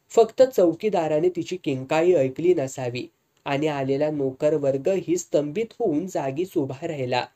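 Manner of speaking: usual speaking voice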